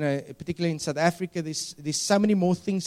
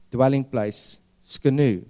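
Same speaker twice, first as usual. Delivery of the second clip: ordinary delivery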